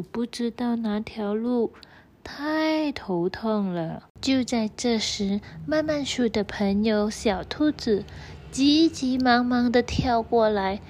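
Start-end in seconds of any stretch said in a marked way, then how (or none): none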